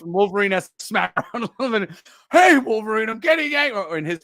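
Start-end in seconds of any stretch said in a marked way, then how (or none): 2.3-3.8 whiny Morph. Pretend. Voicing Morph as Hulk.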